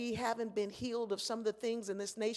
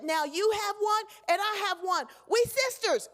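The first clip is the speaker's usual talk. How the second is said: put-on much higher and louder, a proud small child; little girl voice; 11 semitones higher, 9 dB louder than usual. Little girl tells the lady they are sisters, high and proud. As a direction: announce it like a happy little kid.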